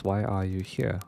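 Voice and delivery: usual speaking voice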